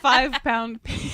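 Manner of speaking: slow punchline. Pretend. Joke punchline drawn out.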